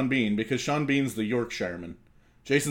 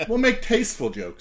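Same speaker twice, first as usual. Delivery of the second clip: mock tasteful voice; 8 semitones higher than usual. Mock posh repeat of joke line.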